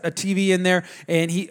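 usual speaking voice

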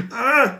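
put-on a frustrated kid's groan; imitating a child's frustrated grunt. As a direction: play the stuck little boy grunting. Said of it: "I can hear him struggling".

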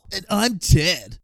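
slow surfer dude voice; laid-back stoner drawl; Ted voice. Acted. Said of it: "drop the voice".

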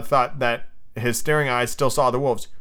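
plain reading voice

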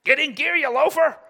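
hammed-up harsh rebuke; mock scolding. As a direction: bark at a lazy brother. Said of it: "what's that warning?".

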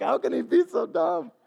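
high, put-on incredulous voice; mock audience. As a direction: play the listeners doubting him. Imagined audience thought.

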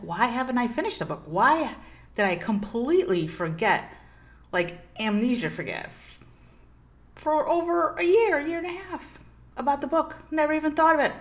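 high amazed self-scolding; incredulous disbelief. Retold or imitated. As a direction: squeak it out in shocked disbelief. Shocked at forgetting the book.

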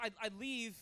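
usual speaking voice

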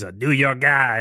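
light mock New York accent; a hint of stock New York accent. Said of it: "He's got the New York thing".

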